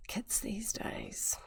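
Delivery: whispered, slow grumble under the breath; muttered aside. Pretend. Cuckoo's imagined thought about Griselda, whispered and slow. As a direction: sigh it out like a weary old bird. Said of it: "what I'm imagining him thinking".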